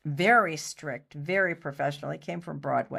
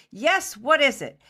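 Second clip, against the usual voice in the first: snappish, higher and louder; impatient director; 7 semitones higher, 6 dB louder than usual. Retold or imitated. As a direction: brush her off, he's busy.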